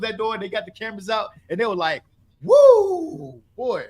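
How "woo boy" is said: a high whoop settling into giddy bragging; hammed-up locker-room cheer; mock whooping. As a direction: whoop it up like the cameras are off. Players celebrating once the cameras are out.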